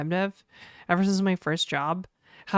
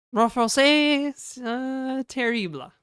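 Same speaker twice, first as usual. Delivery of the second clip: mock French accent; 5 semitones higher than usual. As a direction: lay on a terrible French accent. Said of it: "terrible French accent".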